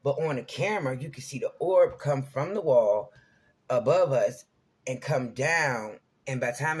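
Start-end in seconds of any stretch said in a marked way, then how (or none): none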